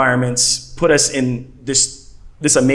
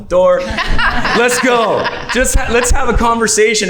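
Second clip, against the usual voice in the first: high, laughing retold quote; laughing, much higher voice; 10 semitones higher, 4 dB louder than usual. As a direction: laugh through the quote as it tumbles out. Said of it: "and I'm like".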